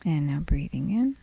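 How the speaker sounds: soft, unhurried body-scan voice with long pauses; guided meditation. Acted. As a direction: gently lead them through the body, leaving space between phrases.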